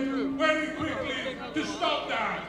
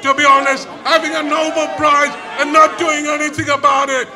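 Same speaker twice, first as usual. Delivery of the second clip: shouting protest speech, rising in force; rally speech; 7 dB louder than usual. Real, not acted. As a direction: rouse the crowd, louder and louder.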